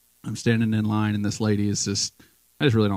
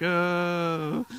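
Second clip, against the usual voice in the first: surprised oh; 9 semitones higher than usual. Retold or imitated. Realizes he speaks English.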